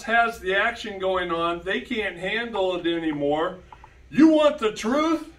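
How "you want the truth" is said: movie line. Pretend. Quoting famous movie line.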